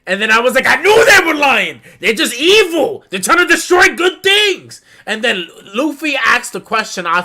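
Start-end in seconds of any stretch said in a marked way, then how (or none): 0.7-4.8 loud, high, indignant rant; loud high fanboy outrage; mock outraged rant. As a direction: rant it like they betrayed you personally. Retelling own rant about villains.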